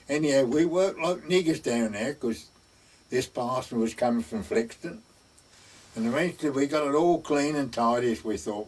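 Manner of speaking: ordinary delivery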